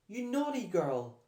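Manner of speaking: high, loud scolding; scolding mother. A narrator's reading. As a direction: scold your runaway daughter. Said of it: "she scolded".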